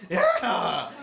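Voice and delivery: mock triumphant yelp, high and loud; Howard Dean scream. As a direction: blurt a hammed-up victory yell. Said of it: "and he's like".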